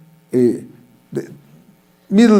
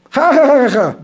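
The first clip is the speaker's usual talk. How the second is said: mock girlish laughter; 10 semitones higher than usual. Classmates laughing.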